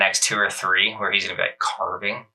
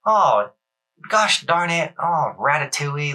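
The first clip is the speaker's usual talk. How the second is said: prim, higher, slow fake swearing; mock polite cursing; 5 semitones higher, slower than usual. Polite non-swearing Roman didn't choose, done as higher, slow mock primness. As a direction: play a goody-goody who won't swear. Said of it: "he didn't choose the".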